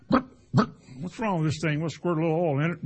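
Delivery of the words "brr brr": high mock stalling wrench brr; impact wrench noise. Preacher mimicking his impact wrench, high sputtering brr. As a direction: sputter it out like a dying air tool. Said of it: "You go".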